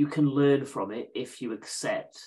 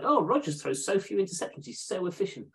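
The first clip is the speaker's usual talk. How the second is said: higher-pitched mock-smug gushing; smug fans; 8 semitones higher than usual. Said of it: "very smug Packers fans last year".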